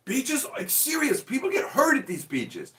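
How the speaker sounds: high-pitched, exasperated protest; Carly, upset. Retold or imitated. Imitating someone defending a beach show, high and upset. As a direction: squeal it, insisting it's serious. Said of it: "the more upset Carly is getting".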